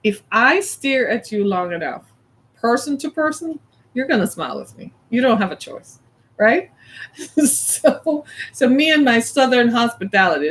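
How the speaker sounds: usual speaking voice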